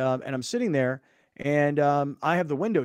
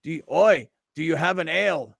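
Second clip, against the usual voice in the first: mock Australian; 2 semitones higher, 5 dB louder than usual. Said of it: "He goes".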